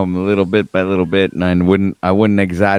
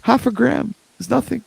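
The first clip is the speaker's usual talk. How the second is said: cutesy downplaying; 13 semitones higher, 4 dB quieter than usual. Playing down the mushroom dose.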